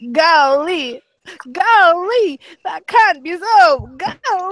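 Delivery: high, loud exaggerated Southern surprise; hammed-up Southern "golly, that can't be so"; mock Southern caller. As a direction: ham up a shocked Southern man. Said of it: "someone in the South".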